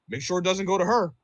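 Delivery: hammed-up petty guest keeping money from her; mock spiteful customer. Customer spitefully withholding tip.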